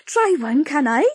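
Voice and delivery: high, loud gushing busybody; gushing Blodwin. Acted. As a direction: neighbour gushing over the baking. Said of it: "cried Blodwin".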